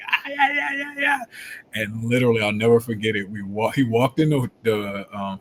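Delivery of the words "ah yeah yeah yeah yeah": mocking coach. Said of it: "He's like".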